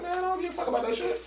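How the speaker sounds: high, fast teasing brush-off; joking. Retold or imitated. Joking brush-off to the supervisor, high and quick. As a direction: toss it off like a joke, grinning. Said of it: "in my joking way".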